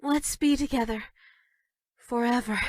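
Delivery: usual speaking voice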